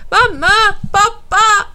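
high, loud, slow drawn-out call. A narrator's reading. Alf calls his parents again, calling out. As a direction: holler for both parents. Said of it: "ropar Alf igen".

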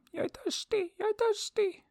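very high, tiny squeaky bird cry; little bird calling. A narrator's reading. Little yellow bird flies off calling out, very high and squeaky. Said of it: "flög iväg och ropade".

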